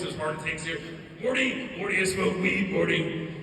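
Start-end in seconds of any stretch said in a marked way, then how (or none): 1.2-3.3 loud cartoon mad-scientist impression; Rick. Brother confesses weed habit, loud Rick voice. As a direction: imitate a cartoon character badgering a sidekick. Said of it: "Rick from Rick and Morty voice".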